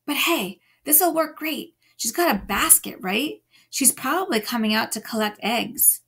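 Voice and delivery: ordinary delivery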